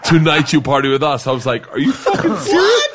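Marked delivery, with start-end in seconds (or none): 0.0-1.3 laughing mock Russian accent; a sly Russian cop, half laughing. Cop inviting the student to party, light Russian accent. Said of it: "and he goes". 1.7-2.9 high, laughing disbelief. Retold or imitated. Student hearing the cop's invitation, high laughing disbelief. Speaker's astonished retold reply.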